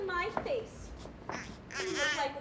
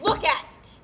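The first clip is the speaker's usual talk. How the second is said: louder, forceful command; loud insistent demand; 9 dB louder, slower than usual. Acted. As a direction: shove the words at him.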